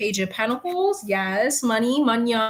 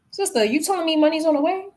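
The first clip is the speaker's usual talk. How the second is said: excited question; 7 semitones higher, faster than usual. Real, not acted.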